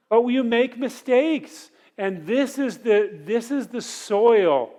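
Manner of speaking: slow, ringing sermon emphasis; emphatic preaching. Real, not acted. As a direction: hammer the point home from the pulpit. Preacher driving the point home.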